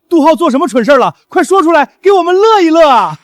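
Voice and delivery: high, loud, eager jeering; gleeful demand. Acted. Eager to hear rival's blunder, gleeful demand.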